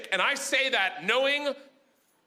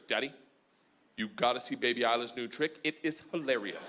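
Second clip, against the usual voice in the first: put-on deadpan kid; 10 semitones lower, 5 dB quieter than usual. Child's deadpan pitch to dad.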